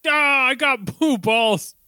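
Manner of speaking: slow, whiny complaint; whiny Nappa voice. Retold or imitated. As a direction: whine it out slowly. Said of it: "and is like".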